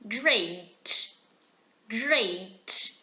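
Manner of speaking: Great said slow as "greit"; model pronunciation. Shown as a demonstration. Teacher modelling Great for students, slow and clear. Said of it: "A segunda palavra".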